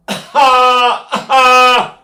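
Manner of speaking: put-on loud high baby wail; baby cry. Shown as a demonstration. Voice teacher announces a baby cry demo, loud high wail. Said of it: "I'm gonna cry like a baby".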